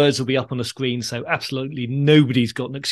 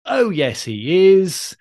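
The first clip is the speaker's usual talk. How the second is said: higher, louder sing-song contradiction; panto audience retort; 6 semitones higher, 4 dB louder, much slower than usual. Acted. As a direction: chant it back like a panto crowd. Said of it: "And then we get".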